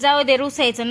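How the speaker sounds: coaxing. Real, not acted. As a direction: urge her to drop the sulk. Mother urging daughter to eat.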